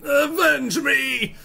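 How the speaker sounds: high, slow, shouted dying plea; overacted death scene. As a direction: ham up a melodramatic last cry. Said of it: "Very, very dramatic".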